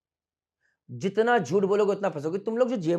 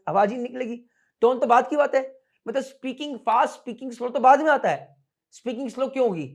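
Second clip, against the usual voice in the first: ordinary talk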